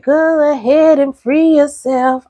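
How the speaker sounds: a light high, slow squeaky diva voice. Retold or imitated. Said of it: "She said".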